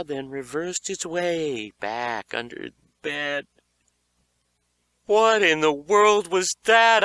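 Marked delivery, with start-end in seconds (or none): 5.0-7.0 loud startled question; shocked exclamation. A narrator's reading. Josh exclaims to Beaver after the growl, loud and shocked. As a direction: blurt it out in shock. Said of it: "I exclaimed".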